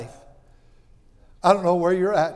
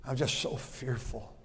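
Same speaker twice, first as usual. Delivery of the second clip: whispered, trembling plea; fearful whispered prayer; 5 semitones lower, 5 dB quieter, slower than usual. Retold or imitated. Preacher reenacting his private prayer to God, whispered. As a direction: hush it like a scared prayer. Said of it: "I walk with God and I said".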